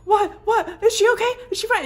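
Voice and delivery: high, loud alarmed questions; panicked questions. Retold or imitated. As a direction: blurt them out in sudden fear for the baby. Panicked reply to the nurse.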